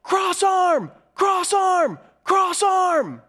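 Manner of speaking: high, loud repeated coaching shout; parents' yell. Retold or imitated. Parents in the stands yelling at their wrestling son, hammed-up shout. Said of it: "And they both yelled".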